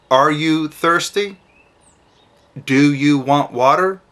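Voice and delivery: slow, simple, spaced-out question; slow short question. Shown as a demonstration. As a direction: ask it gently, a few words at a time. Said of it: "Just say".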